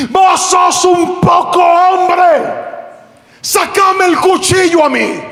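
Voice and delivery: high, drawn-out shouted dare; angry challenge. Acted. Pastor challenging the thief who threatened girls, angry shout. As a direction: shout the insult slowly and dare him.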